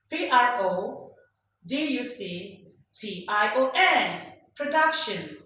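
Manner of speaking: P R O, D U C T I O N spelled slowly, each group lifted; slow classroom spelling of "Production" with lifted endings; rising spelling tone. Shown as a demonstration. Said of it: "the last letter must go rising".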